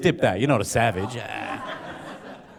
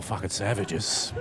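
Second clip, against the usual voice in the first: ordinary talk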